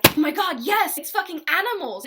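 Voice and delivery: high, loud excited yes; a friend who finally hears it too; excited recognition. Retold or imitated. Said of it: "he was like".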